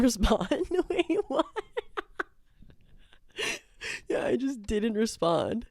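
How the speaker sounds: laughing. Real, not acted. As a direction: break into laughter mid-line. Laughing at own ghosting.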